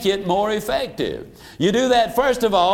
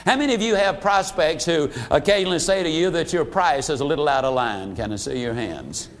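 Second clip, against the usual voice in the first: usual speaking voice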